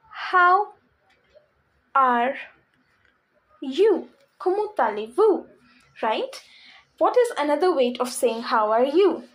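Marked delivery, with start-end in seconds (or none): 0.1-4.1 slow word by word. Shown as a demonstration. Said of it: "slow word by word".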